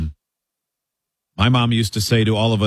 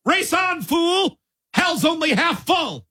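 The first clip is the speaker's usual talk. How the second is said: very high-pitched, drawn-out mock holler; mock shouted catchphrase; slower than usual. Host imitating someone's mom yelling at a too-fast driver, mock shout. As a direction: holler it high and slow, as if between cars. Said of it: "sound like something that would have been shouted".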